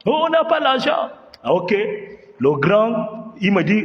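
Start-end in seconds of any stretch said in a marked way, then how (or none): 0.1-1.1 acted confrontation. Robbers demanding money from the migrants, in high acted voices. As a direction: bark the questions, whine the answers.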